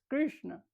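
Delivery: Krishna with a curled-back sound; retroflex Sanskrit pronunciation. Shown as a demonstration. Teacher stressing the correct form to students, Krishna said retroflex. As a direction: pull the tongue back for Krishna. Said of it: "es retroflexa".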